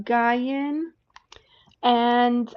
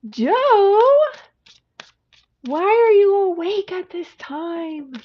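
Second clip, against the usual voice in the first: loud, high exclamation at a friend; high-pitched, loud mock telling-off; surprised call-out; 9 semitones higher, 8 dB louder than usual. Real, not acted. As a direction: call out loudly in disbelief, pitch up. Calling out a viewer awake late.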